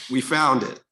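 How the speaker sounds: a delighted laugh through the line. Real, not acted. Panelist revealing the smoking gun, laughing.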